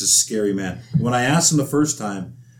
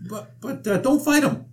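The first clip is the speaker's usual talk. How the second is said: squeaky coach voice pitched way up; high-voiced coach. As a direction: imitate the coach's high nervous plea. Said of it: "Dave's got a very high voice".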